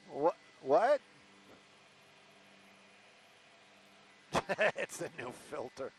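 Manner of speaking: thin, tinny phone-line voice; tinny talking telephone. Real, not acted. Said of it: "you sound like an old telephone today".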